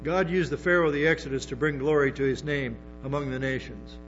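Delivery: normal talking voice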